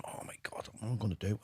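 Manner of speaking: breathy whispered dread; scared whisper, voice barely there; panicked whisper. Retold or imitated. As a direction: whisper it as if caught out. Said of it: "like, going".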